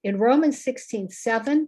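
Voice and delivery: normal talking voice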